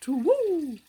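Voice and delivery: high, loud hooting call; owl hoot. Shown as a demonstration. Showing a child the owl, loud hoot. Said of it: "It's an owl".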